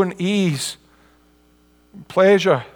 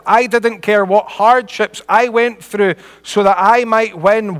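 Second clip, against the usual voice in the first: raised, emphatic preacher's reading; preaching a quotation; 3 semitones higher, 4 dB louder than usual. Real, not acted. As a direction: read the testimony out with preaching fervor. Reads missionary's words with preaching force.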